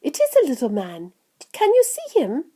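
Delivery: put-on eager boy, pitch rising high; mildly excited cry. A narrator's reading. Charlie urging Grandpa to look, mildly excited. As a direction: ask with a touch of excitement. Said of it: "cried Charlie".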